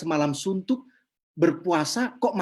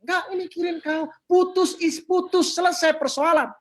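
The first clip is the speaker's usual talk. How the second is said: tegas bernada tinggi, diseret; omelan tegas; 11 semitones higher, 3 dB louder than usual. Retold or imitated. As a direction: tegaskan dengan suara tinggi dan lambat. Menegaskan putus cinta sudah selesai. (omelan tegas, nada tinggi)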